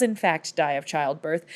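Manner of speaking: usual speaking voice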